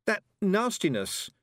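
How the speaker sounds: put-on high, slow, upset girl; mildly tearful, drawn-out words; gulped distress. A narrator's reading. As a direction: force it out after a gulp. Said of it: "she gulped".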